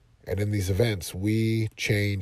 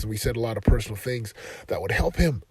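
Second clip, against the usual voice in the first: usual speaking voice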